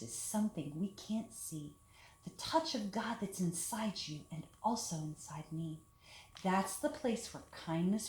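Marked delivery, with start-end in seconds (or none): none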